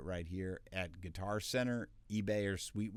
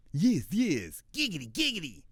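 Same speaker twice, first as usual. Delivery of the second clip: much higher, louder cartoon voice; cartoon catchphrase voice; 9 semitones higher, 8 dB louder than usual. Shown as a demonstration. Voice actor rattling off a cartoon catchphrase. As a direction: giggle the catchphrase.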